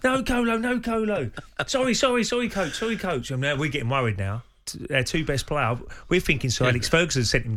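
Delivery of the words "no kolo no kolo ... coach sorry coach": panicked cry then apologies. Retold or imitated. Ray retelling the training clash, high panicked shout fading to apology.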